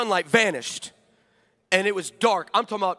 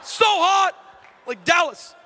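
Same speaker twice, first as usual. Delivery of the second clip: high, loud, slow preacher cry; hammed-up shouting preacher; mock revival shout; 10 semitones higher, 6 dB louder, much slower than usual. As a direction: holler it like a tent revival. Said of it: "You're like".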